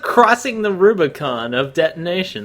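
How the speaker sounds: ordinary delivery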